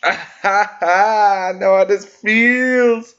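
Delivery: laughing. Real, not acted. Laughing while ranting.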